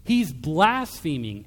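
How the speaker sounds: mock outraged "He's blaspheming"; indignant accusation. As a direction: spit out the accusation like a scandalized scholar. Teachers of the law accuse.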